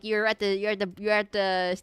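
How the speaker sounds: ordinary talk, nothing marked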